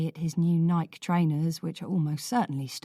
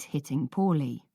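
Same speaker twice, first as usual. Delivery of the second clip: ordinary delivery, nothing marked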